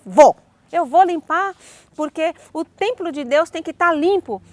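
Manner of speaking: loud, insisting she will clean; retold defiant yell, loud on Vou; defiant shout. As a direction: shout back stubbornly at the secretary. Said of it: "Eu briguei feio com ela".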